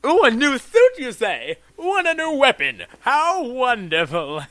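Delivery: high, loud, theatrical oohing; gushing delight. Pretend. Player receiving new gear, high gushing delight. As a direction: gush over it high and loud, stretching the last words. Gushing over game rewards.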